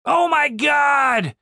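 Dave's panicked outcry; horrified cry. A narrator's reading. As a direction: scream in disbelief. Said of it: "cried Dave".

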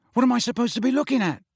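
fast, high-pitched bewildered question; puzzled boy's protest; baffled. A narrator's reading. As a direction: ask in irritated confusion. Baffled Ron can't see the horses.

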